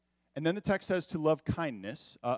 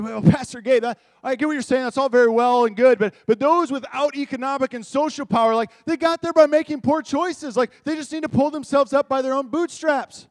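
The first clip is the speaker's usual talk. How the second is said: loud, higher, indignant objector; defensive churchgoer; 9 semitones higher, 10 dB louder than usual. Retold or imitated. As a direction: push back on the pastor, louder and higher. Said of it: "we instantly want to defend ourselves".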